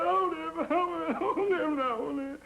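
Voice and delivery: high wailing oh no, hammed-up sobbing; mock crying. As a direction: wail it like a weeping mother in a gag. Woman crying in retold joke.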